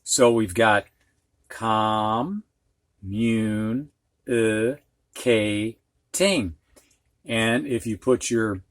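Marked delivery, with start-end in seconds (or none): 1.5-6.5 very slow, each syllable drawn out. Shown as a demonstration. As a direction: stretch communicating out syllable by syllable. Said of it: "say it slowly".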